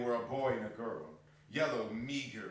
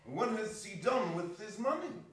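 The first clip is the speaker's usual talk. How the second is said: much higher put-on voice; red-faced gentleman; 11 semitones higher, 4 dB louder than usual.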